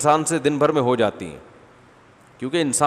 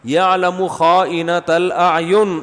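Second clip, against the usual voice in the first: slow chanted Arabic phrase; Quran recitation; 4 semitones higher, 4 dB louder, much slower than usual. Acted. Preacher quoting scripture mid-sermon, slowly chanted. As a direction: intone the verse slowly.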